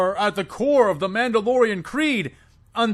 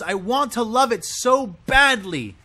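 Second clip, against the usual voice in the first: ordinary talk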